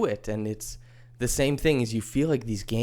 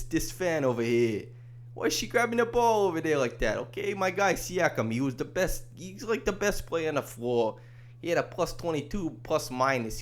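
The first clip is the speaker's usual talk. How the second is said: put-on Brooklyn accent; 8 semitones higher than usual. Brooklyn guy calls someone over to gawk at a fan, Brooklyn accent. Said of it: "maybe I should stop the, the accent".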